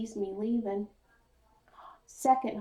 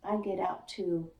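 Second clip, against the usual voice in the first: plain reading voice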